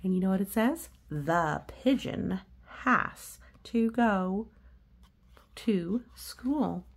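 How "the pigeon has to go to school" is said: slow, drawn-out reading of the title; a teacher reading the title aloud, slow and deliberate. A narrator's reading. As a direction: read each word slowly and clearly.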